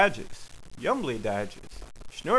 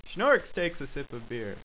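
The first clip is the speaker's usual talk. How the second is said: ordinary delivery, nothing marked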